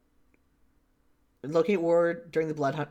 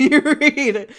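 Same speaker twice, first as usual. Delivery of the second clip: loud, high laugh breaking through the words; real embarrassed laughter mid-sentence; 12 semitones higher, 13 dB louder than usual. Real, not acted. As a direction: crack up while admitting it. Laughing at own missed reading.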